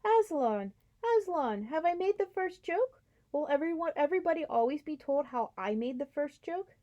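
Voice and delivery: high, eager chirping bird voice; bursting with pride at its joke; excited jackdaw. Acted. Jackdaw asking Aslan about its joke, high and eager.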